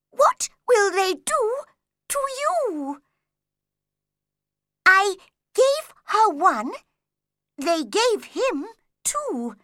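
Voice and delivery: high, slow reading voice. Acted. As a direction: read the puzzling letter out carefully. Rabbit reads the letter.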